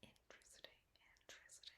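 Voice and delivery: whispered aside, as if to herself; intrigued whisper. Acted. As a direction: quietly whisper private interest. Acting Silva privately intrigued.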